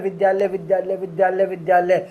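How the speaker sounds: rapid repeated विद्यालय; said fast. Shown as a demonstration. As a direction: rattle off विद्यालय quickly. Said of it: "जब हम तेज़ी में बोलते हैं". (तेज़ी में बोलना)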